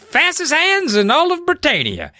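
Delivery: high-pitched put-on British voice; British accent. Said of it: "now they have British accents".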